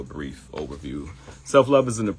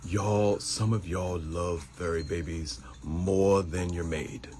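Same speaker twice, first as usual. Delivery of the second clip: lightly voiced Paul Mooney, slow and dry; slow deadpan comic jab; a hint of Paul Mooney's dry drawl; Paul Mooney impression; 3 dB quieter, slower than usual. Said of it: "I feel like Paul Mooney".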